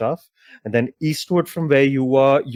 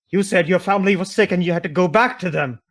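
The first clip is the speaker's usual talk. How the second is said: higher-pitched acted reproach; indignant accusation; 7 semitones higher, 3 dB louder than usual. Tiefling woman confronts lying lover, indignant. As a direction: hurl the accusation in a raised pitch.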